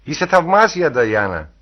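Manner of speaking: narrated exclamation; called out. A narrator's reading. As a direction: call the praise across to her. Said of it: "φώναξε ο Χέιτον".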